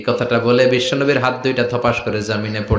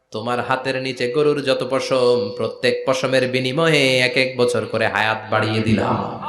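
usual speaking voice

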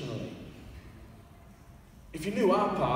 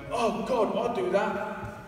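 dismayed realisation; 6 semitones higher, much slower than usual. Retold or imitated. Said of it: "That wasn't an "Oh, God," like, cussing God".

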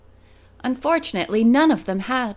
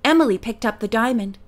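usual speaking voice

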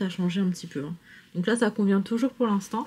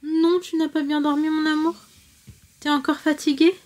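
sleepy husky good morning; 8 semitones higher, 3 dB louder than usual. Real, not acted. Still waking her toddler.

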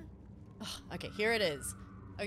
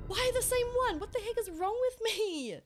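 exasperated; 10 semitones higher, 5 dB louder than usual. Real, not acted. Frustrated after falling again.

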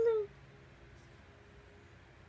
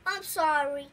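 ordinary delivery

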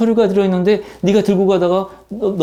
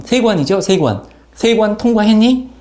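normal talking voice

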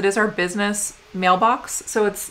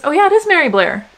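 surprised recognition; 8 semitones higher, 8 dB louder than usual. Real, not acted. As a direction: pitch jumps up and louder, oh yeah of discovery. Recognising the postcard artist.

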